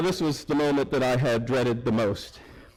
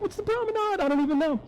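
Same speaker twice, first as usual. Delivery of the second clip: baffled. Retold or imitated. Baffled guests at fancy hotel.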